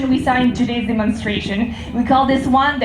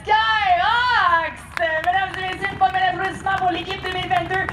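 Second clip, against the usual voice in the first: airshow commentator; 5 semitones higher than usual. Real, not acted. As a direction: build up to the team name. Final salute announcement.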